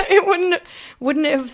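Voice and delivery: high and loud, breaking into laughter; laughing. Real, not acted. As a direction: laugh through the words. Laughing mid-sentence.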